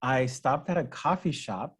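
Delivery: usual speaking voice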